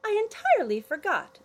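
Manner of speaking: put-on sheepish girl admitting.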